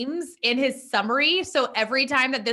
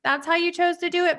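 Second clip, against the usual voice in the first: sarcastic; 7 semitones higher than usual. Retold or imitated. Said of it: "I'm just like".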